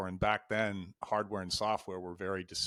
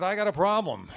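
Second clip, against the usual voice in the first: higher and louder, nervously echoing the line; a rookie forcing out the boss's words; nervous repeat; 9 semitones higher, 7 dB louder than usual. Retold or imitated. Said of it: "And so I said".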